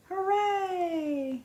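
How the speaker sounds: high, drawn-out faraway hooray; echo shouting back. Acted. The echo shouting hooray back to the animals, high. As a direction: let it ring back like an echo. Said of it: "the echo shouted back".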